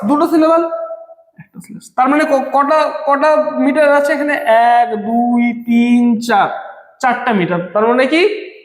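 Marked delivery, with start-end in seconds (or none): 4.3-6.5 slow count on a level pitch; drawn-out counting. Shown as a demonstration. Teacher counting the metres in the line, slowly drawn out. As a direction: stretch each number out.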